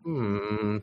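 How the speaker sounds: low, soft "hmm"; pensive hum. Acted. Player's character sitting in the sunbeam, low pensive hum. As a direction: hum it quietly, pitched well down. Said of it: "and I'm like".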